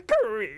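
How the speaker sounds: high, drawn-out door creak. Acted. Slasher opens the door, high creak sound effect.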